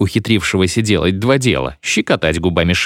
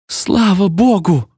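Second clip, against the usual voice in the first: high, drawn-out exclamation of relief; exultant young bride's outburst; joyful cry; 11 semitones higher, slower than usual. A narrator's reading. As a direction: cry out in delight, pitched way up. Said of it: "вскричала невеста".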